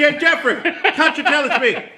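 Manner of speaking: high, hammed-up Irish voice; teasing stage Irish, pitched high; mock Irish accent. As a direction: play the Irishman, barely holding the laugh. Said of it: "put his Irish accent".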